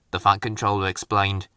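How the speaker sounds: ordinary delivery, nothing marked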